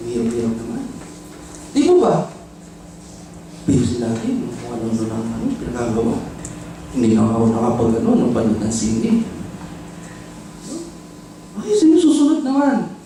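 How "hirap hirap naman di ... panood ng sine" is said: low, muttered complaining under the breath; mock grumbling. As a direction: grumble like a reluctant servant. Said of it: "Pero grumbling".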